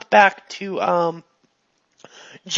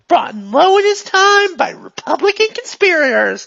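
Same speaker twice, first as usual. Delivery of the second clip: higher, louder, building to a cry; worked-up rant; 8 semitones higher, 5 dB louder than usual. Acted. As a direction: get carried away ranting. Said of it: "she's getting into it now".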